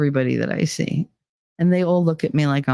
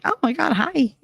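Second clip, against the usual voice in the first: gushing hello; 9 semitones higher than usual. Retold or imitated. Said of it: "I get all like".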